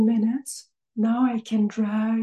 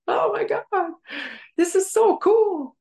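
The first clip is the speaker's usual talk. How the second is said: giddy delight; 8 semitones higher than usual. Retold or imitated. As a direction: squeal like a kid over a treat. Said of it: "I'm, like, smelling the strawberry like a nut".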